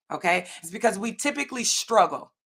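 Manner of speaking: ordinary talk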